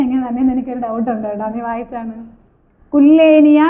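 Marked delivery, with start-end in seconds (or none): 2.9-3.6 കുല്ലേനിയ said loud with a long "lle"; reading out pronunciation. Shown as a demonstration. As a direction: sound out കുല്ലേനിയ loudly as kul-LAY-ni-ya. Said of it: "ഞാൻ ഇങ്ങനെയാണ് വായിക്കണം". (കുല്ലേനിയ ഉറക്കെ, "ല്ലേ" നീട്ടി ഉച്ചരിച്ച്)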